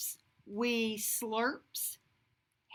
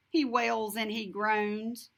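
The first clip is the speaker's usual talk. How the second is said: ordinary delivery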